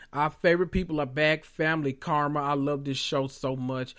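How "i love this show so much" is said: ordinary talk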